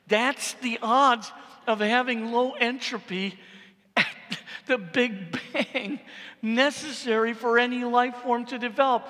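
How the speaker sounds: dramatic preacher emphasis. Acted. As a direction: preach it, leaning hard on each word. Dramatic restatement of the claim.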